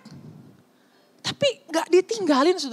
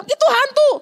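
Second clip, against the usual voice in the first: high, loud frightened exclamation; hammed-up alarm at a ghost; mock scared cry; 8 semitones higher, 5 dB louder than usual. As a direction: blurt it out like spooked disciples. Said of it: "Waktu murid-murid bilang".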